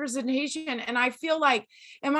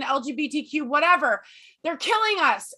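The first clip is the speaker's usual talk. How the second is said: usual speaking voice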